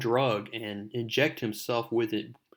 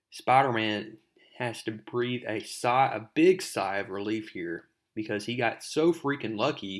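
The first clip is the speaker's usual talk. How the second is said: usual speaking voice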